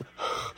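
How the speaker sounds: demonstrative out-of-breath puffs; winded panting. Shown as a demonstration. Dad showing how car seat installing leaves you, winded panting. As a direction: pant as if worn out from the job. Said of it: "you are out of breath".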